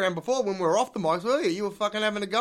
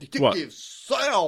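slow, drawn-out mock detective introduction; mocking imitation; much slower than usual. Co-actor mocking the other's detective character, drawn out. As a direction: mimic his self-introduction, stretching it out. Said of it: "You having a go?".

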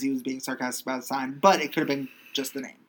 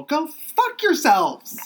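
high, drawn-out indignant cry; mock outrage; 12 semitones higher, 5 dB louder, much slower than usual. Host cursing the SpongeBob musical's Times Square display, mock outrage. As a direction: blow up in exaggerated disgust, pitched way up.

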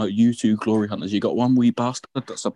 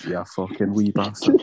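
mock Scottish; 2 semitones lower, slower than usual. Teasing Scottish friend, drawn out.

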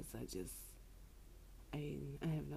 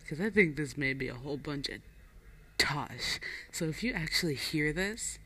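loud; 5 dB louder than usual. Real, not acted. Loud tipsy sign-off.

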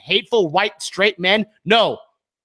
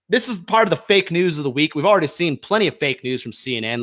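normal talking voice

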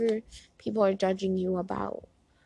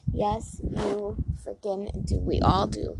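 normal talking voice

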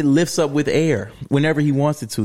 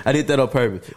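normal talking voice

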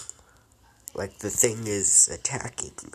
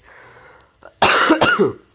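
loud harsh cough; coughing fit; 16 dB louder than usual. Real, not acted. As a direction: cough hard into the mic. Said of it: "sorry that I'm coughing a lot".

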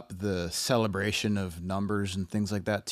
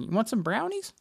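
much higher, sing-song doting mother; sweet mom voice; 12 semitones higher than usual. Retold or imitated. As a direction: coo it like an eager, doting mom. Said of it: "that's the voice that I'm projecting on it for her".

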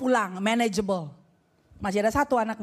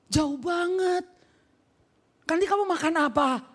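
worried mama; 2 semitones higher than usual. Acted. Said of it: "Mamanya bilang". (rengekan mama khawatir yang dibuat-buat, mendayu panjang)

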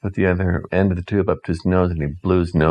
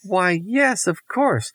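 put-on girl answering; 13 semitones higher, slower than usual. Doris answers Mother.

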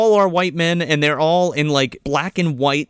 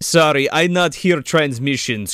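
put-on Russian accent. Voicing Alexander in broken English.

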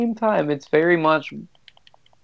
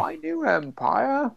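a hint of Anakin, higher and slow; imitated movie villain; 8 semitones higher, 5 dB quieter, slower than usual. Co-host mocks a line from the Star Wars film, imitated villain. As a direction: draw it out slowly in a lightly put-on villain voice.

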